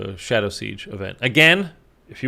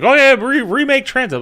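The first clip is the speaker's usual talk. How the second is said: much higher, louder, mocking the fans; 13 semitones higher, 6 dB louder than usual. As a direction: parrot them in a silly high pitch. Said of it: "people were like".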